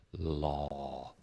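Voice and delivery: "law" slow, low and open from the chest; deep aw sound. Shown as a demonstration. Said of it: "coming from way down deep in my vocal cords".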